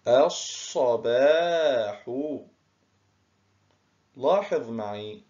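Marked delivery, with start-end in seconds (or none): 0.1-2.1 الصباح drawn out very slowly, lam silent and the s doubled; slow demo of as-sabah. Shown as a demonstration. Teacher to pupils, showing the silent sun lam, slowly. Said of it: "كتبت ولم تنطق".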